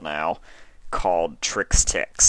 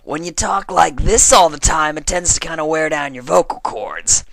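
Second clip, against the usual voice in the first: high, louder cartoon voice; quoting a voice actress in her own character's voice; 11 semitones higher, 9 dB louder than usual. Shown as a demonstration. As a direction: slip back into the character voice for the quote. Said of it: "to quote Ashley Ball".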